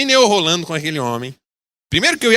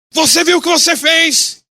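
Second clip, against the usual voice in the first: angry demand; 14 semitones higher, 5 dB louder than usual. Retold or imitated. Angry driver confronting pastor.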